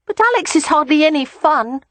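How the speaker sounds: put-on high-pitched child complaining; small boy dismissing his brother; grumbling little boy. A narrator's reading.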